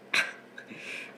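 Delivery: short airy chuckle; breathy laugh. Real, not acted. Host reliving the segment, breathy chuckle. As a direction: chuckle to yourself.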